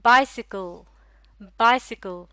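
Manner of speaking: बाइसिकल as one word, loud first beat, falling; careful model pronunciation. Shown as a demonstration. Teacher asking learners to listen carefully, careful model pronunciation. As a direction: say बाइसिकल whole, punching बाइ, then repeat. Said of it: "गौर से सुनिएगा".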